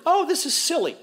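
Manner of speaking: high-pitched, amused scoff; acting out carefree listeners; lighthearted dismissal. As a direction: brush it off as a silly story. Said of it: "It's quite lighthearted".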